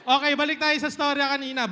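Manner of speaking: usual speaking voice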